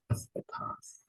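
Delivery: "ascetas" whispered; whispered word. Shown as a demonstration. Teacher whispering "ascetas" while reading Greek verse. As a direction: breathe "ascetas". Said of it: "reading Greek verse aloud".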